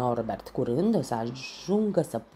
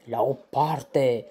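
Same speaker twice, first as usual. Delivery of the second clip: snorting rhino; 7 dB louder, slower than usual. A narrator's reading. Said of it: "pufnește Norbert".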